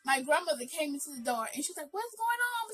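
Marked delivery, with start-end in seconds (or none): 1.9-2.7 much higher-pitched retold astonishment; imitated alarmed question. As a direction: imitate a grandmother walking in confused. Grandmother alarmed on arriving.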